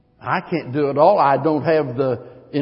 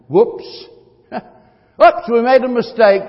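higher, louder oops with a laugh; play-acted chagrin, pitched up; mock sudden realization; 8 semitones higher, 5 dB louder, slower than usual. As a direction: act out catching your own blunder. Acting out the people's sudden regret.

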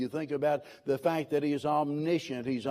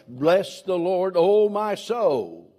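raised, slow proclamation of the psalm; emphatic call; 4 semitones higher, 6 dB louder, slower than usual. Acted. Preacher proclaiming the psalm to the congregation, raised and slow. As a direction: lift it up and draw out the soul. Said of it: "this is not vain repetition".

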